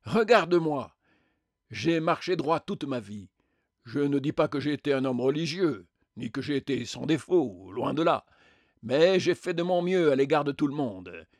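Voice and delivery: insistent, indignant self-defence; self-righteous spectre. Acted. Spectre defends his upright life, self-righteous and insistent. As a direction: proclaim a blameless life with force.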